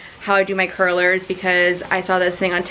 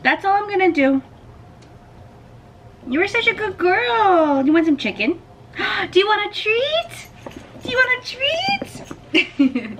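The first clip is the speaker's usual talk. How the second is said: talking to a dog; 12 semitones higher than usual. Real, not acted. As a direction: gush over the pet with delight. Praising dog, offering treat.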